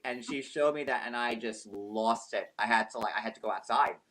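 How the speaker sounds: ordinary talk, nothing marked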